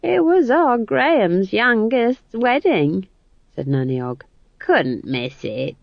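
put-on jolly old woman voice, slow; lightly voiced homely old gossip; Nanny Ogg. Nanny Ogg excusing her absence, homely.

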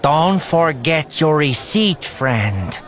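high, loud, slow creature voice; alien clerk. A narrator's reading. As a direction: growl it out slowly as an alien cashier. Same Capoan clerk speaking.

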